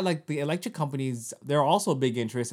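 usual speaking voice

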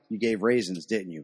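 low, mock devilish taunt; hammed-up Satan gloating low and soft. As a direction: taunt the sinner like the devil himself. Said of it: "Satan is gonna actually take you in and be like".

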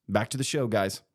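normal talking voice

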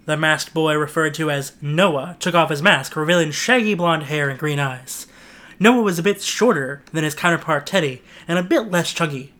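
usual speaking voice

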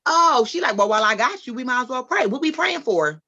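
high, loud cheerful church lady; a chirpy lady seizing the moment to pray; bright church lady. Retold or imitated. Church lady on the phone offers to pray, high and bright.

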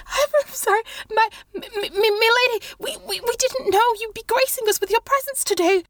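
a terrified novice stumbling over apologies; panicked young monk. Acted. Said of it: "the young monk is panicked and he, he stutters".

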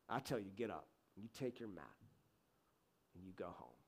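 low, hushed, measured authority; quiet command. Acted. Jesus telling the paralytic to get up, quiet command. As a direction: give the order softly and low, with pauses.

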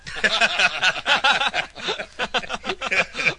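loud delighted belly laugh; booming happy laugh at the birthday joke; big hearty laugh. Acted. Father delighted at birthday news.

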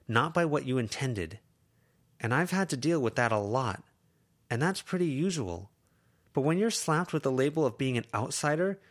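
lightly voiced teen, firm and steady; earnest lecture. Lucy lecturing Avery, earnest and firm. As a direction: lay out a hard truth to a friend.

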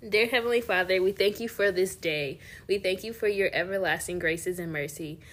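soft, earnest prayer voice. Acted. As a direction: pray gently over the listeners. Leads a prayer.

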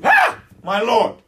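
loud gasped Ah, then slow high cry; joyful recognition. Acted. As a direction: cry out as you suddenly know him. Mary recognises the risen Jesus.

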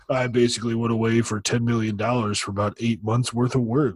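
low mocking impression of a streamer; stupid deep voice. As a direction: drop way down into a dopey deep voice. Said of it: "has that stupid deep voice".

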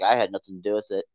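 a hint of fake innocence; innocent denial. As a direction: play it innocent, brushing it off. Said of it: "Muffy's like".

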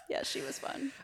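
laughing. Real, not acted. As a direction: giggle it out. Laughing after a joke.

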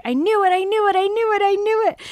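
high, loud, breathless repetition; excited triumph. Acted. Man hearing his date is gorgeous, excited. Acting the thrilled man's repeated cry.

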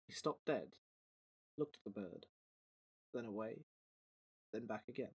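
low, soft storyteller read; story narration. A narrator's reading. Narrator reading the story to podcast listeners, low and soft. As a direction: settle in and tell the tale quietly.

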